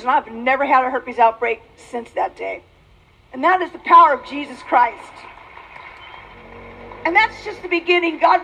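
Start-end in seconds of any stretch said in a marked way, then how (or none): none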